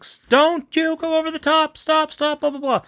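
hammed-up high-pitched alarm; mock frantic officer. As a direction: squeak out the orders like a flustered officer. Frantic order to stop attack.

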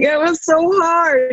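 high, slow mock sob; imitating crying. Runner telling her partner through tears the night before finishing. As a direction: whimper it like retold tears. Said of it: "bawling, just crying".

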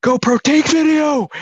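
yelling a voice command; shouting at the camera; screaming. Retold or imitated. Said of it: "I'm, like, screaming at the thing".

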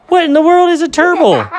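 very high, loud laughing question; high laughing incredulity; squeaky disbelief. Acted.

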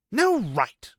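sharp, loud snap; loud angry retort at the Aiel; snapped. A narrator's reading. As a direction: bite the words off in anger. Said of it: "Nynaeve snapped".

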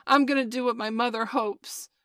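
high, loud frantic cry; hysterical cry. Acted. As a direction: blurt it out wildly. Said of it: "Toodles cried out hysterically".

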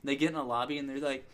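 ordinary talk

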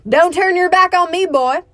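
high, loud challenge to the star; lightly voiced old outlaw squaring up; angry warning. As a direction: call him back hard, spoiling for a fight. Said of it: "Christopherson said".

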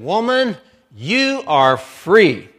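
high, loud call slowing right down; loud proclamation. Retold or imitated. Preacher voicing Jesus healing the bent woman, loud slow proclamation. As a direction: proclaim it with full voice, drawing out each word.

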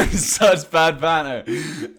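loud, high, drawn-out laughing words; laughing hard. Real, not acted. Co-host laughing at their bad banter, loud and laughing. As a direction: cry it out through helpless laughter.

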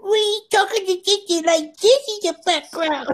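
put-on very high, slow baby talk; talking to a dog voice. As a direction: coo like talking to a puppy. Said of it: "the talking to his dog voice".

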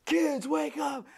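high, drawn-out mock call. Parents waking kids to flee spiders, calling out. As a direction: holler it slowly up to the kids.

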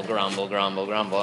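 low mumbled grumble, grumble; grumbling noise. Shown as a demonstration. Preacher showing how we grumble, low muttered. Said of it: "we just grumble".

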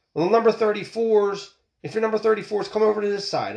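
high mock woman official calling the line; poll worker calling out. Poll worker calling the crowd, mimicked in a high voice. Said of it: "a woman comes out and says".

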